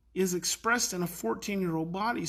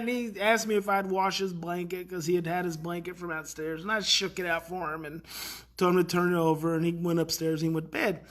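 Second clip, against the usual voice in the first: usual speaking voice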